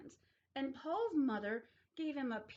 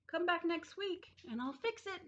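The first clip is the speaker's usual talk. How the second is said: lightly voiced dentist; 8 semitones higher than usual. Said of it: "said Doctor Fields".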